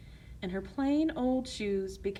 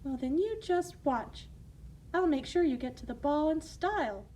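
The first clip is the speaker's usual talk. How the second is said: high, sweet motherly voice; kind fairy godmother; 6 semitones higher than usual. Acted. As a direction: reassure her gently.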